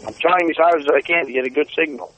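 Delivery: ordinary talk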